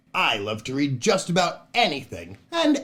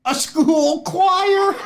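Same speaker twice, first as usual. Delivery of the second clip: silly punchline; 6 dB louder, slower than usual. Acted. Host delivers joke punchline.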